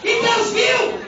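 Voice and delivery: shouted preaching. Acted. Preaching shout about mother.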